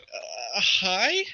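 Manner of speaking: hesitant, soft greeting lifting high; stunned, questioning hello; bewildered guard. Acted. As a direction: answer warily, thrown off completely. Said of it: "And the guy's like".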